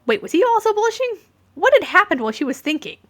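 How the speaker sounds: bewildered double take; startled. A narrator's reading. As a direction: sister startled by the sudden chaos. Gin's startled thoughts.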